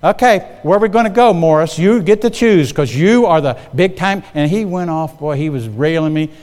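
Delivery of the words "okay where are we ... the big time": mock deference, higher-pitched and heavily stressed; sarcastic. Annoyed boss letting the holdout pick the place, sarcastic. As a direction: imitate a boss needling his employee with sarcasm. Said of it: "he got so sarcastic".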